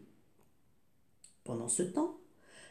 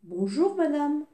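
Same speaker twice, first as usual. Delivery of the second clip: put-on small boy's voice, higher and very polite; boy asking the giantess for food, politely; polite little boy; 7 semitones higher than usual. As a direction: ask the giantess nicely, higher than the narration. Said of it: "il la salua bien poliment".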